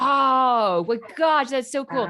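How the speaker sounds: loud, high gush of admiration; retold delighted awe. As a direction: re-enact being thrilled, big and high. Acting out her delighted reaction.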